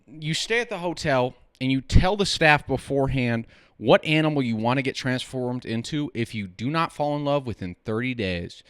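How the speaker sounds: usual speaking voice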